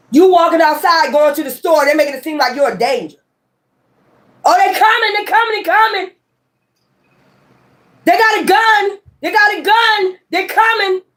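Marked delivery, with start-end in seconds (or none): 4.4-11.0 high, frantic mock alarm; imitating a panicked false report to police. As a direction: play the hysterical caller crying wolf.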